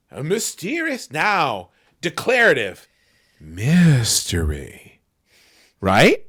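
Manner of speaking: slow, drawn-out announcer read; hammed-up trailer voice; dramatic read. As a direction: intone it like a mystery trailer. Dictating dramatic ad copy.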